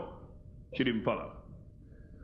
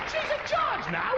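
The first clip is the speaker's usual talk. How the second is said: high, slow comic emphasis; slower than usual. Acted.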